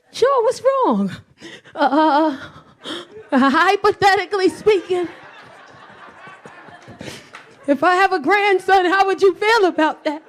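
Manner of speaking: nervous sweet voice. Acted. Acting out nervous talk with boyfriend.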